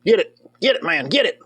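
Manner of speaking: ordinary delivery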